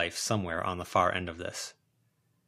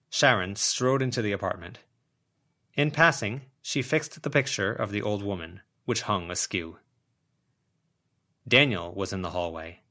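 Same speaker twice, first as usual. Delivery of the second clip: ordinary delivery, nothing marked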